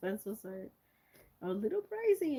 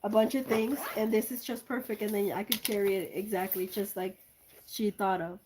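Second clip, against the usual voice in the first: usual speaking voice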